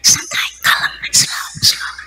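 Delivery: hushed, voiceless calming; soft whispered reassurance; soothing whisper. Acted. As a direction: whisper it to cool someone down. Soothing an angry wife, whispered.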